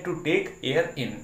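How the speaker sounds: To take air in spelled out very slowly; teacher reads the definition word by word, very slowly; slow dictation. Shown as a demonstration. Teacher dictates definition slowly to the class. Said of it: "और यहाँ पे क्या है".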